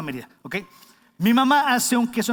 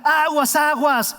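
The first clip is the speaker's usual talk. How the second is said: sharp loud warning call, higher; warning shout; 7 semitones higher, 6 dB louder, slower than usual. Retold or imitated. Someone on the street shouting a warning loudly. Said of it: "alguien te grita".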